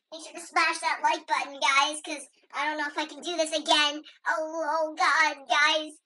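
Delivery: ordinary delivery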